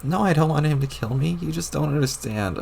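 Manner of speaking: ordinary talk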